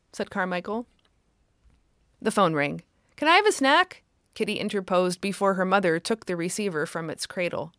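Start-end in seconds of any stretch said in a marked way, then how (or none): 3.2-4.0 put-on high little girl asking quickly; eager child. A narrator's reading. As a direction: chirp it like an eager kid. Child asks interrupting.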